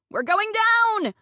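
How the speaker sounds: high, loud, drawn-out alarm cry; panicked scream. A narrator's reading. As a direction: scream it like a sinking-ship warning. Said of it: "a voice screams in my head".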